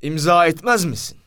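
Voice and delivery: commissioner's indignant question; angry challenge. A narrator's reading. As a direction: dare him to refuse. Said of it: "Komiser adam akıllı kızmıştı".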